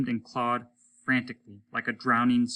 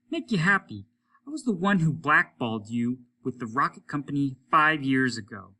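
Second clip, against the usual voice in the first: raised, smug reveal; spiteful confession; 3 semitones higher, 5 dB louder than usual. Acted. Applegate confessing to Hollis, smug and spiteful. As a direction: twist the knife gently.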